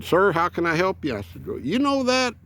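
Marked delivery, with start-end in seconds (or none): 0.0-1.1 put-on high, polite young clerk; phone clerk voice. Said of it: "he said". 1.5-2.3 put-on old hillbilly voice. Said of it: "old man hillbilly voice".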